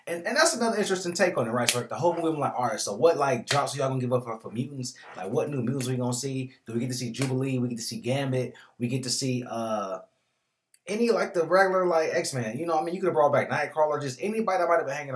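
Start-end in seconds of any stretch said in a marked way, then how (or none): 2.6-12.6 loud, eager fan questions; excited. Retold or imitated. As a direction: rattle off hopeful questions. Excited fan wondering aloud.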